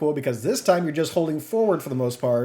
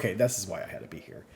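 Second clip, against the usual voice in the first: softer, lower, quick deadpan aside; dry resignation; 5 semitones lower, 7 dB quieter, faster than usual. Retold or imitated. Player realising why he had to stay, quiet and deadpan. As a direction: mutter it off like an afterthought.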